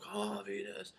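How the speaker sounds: hushed, breathy imitation of a fan; muffled cheer. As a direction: whisper a far-away, smothered cheer. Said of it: "and muffled".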